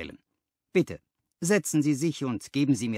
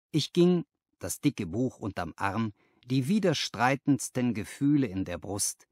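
ordinary delivery, nothing marked

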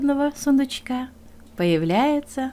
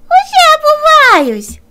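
very high, loud, slow answer; frightened fox; 12 dB louder, slower than usual. Acted. Fox calls down from the stove, very high and loud. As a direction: squeal it like a scared fox.

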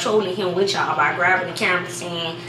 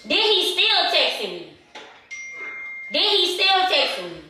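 raised, high-pitched fake distress; fake upset; 8 semitones higher than usual. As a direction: play wounded for the prank. Fake upset prank opener.

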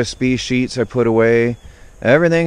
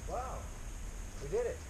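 quiet herding talk, much softer; hushed coaxing; 4 semitones higher, 21 dB quieter than usual. Real, not acted. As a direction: murmur instructions to Sam without spooking the birds. Coaxing chickens back to coop.

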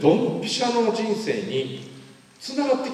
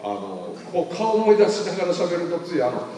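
normal talking voice

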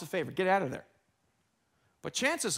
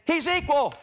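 excited emphatic exclaim; 8 semitones higher, 7 dB louder than usual. Acted. Preacher voicing Paul's point.

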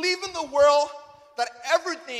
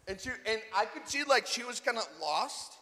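usual speaking voice

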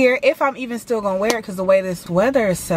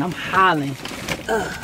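ordinary talk, nothing marked

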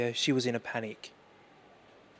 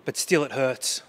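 normal talking voice

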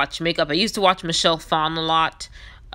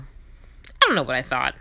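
ordinary talk, nothing marked

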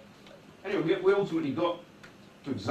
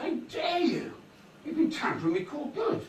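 imitating an outraged man, pitched much higher; retold outrage; 11 semitones higher than usual. Client to the court clerk who sealed his file, indignant high outburst. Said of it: "You know, he's really angry".

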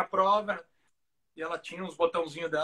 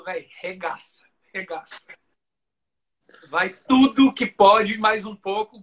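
louder, drawn-out push to go all out; retold urging; 9 dB louder, slower than usual. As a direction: urge the rider on, imitating a trainer. Retold trainer urging rider on.

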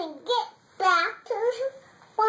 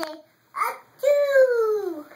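excited cry; 5 semitones higher, 6 dB louder than usual. Acted. Child voicing a story figure.

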